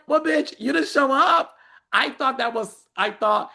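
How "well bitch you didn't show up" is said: sassy. Retold or imitated. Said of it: "it's like".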